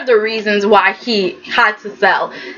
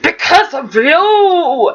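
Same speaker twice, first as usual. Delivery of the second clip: hammed-up furious outburst; 3 semitones higher than usual. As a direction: rage at Luca like a soap villain. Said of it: "and he's like".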